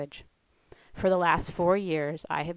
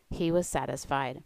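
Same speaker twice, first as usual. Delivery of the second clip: ordinary talk, nothing marked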